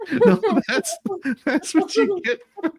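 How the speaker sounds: high, laughing words. Real, not acted. Laughing at the joke to the co-hosts. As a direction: laugh through the line.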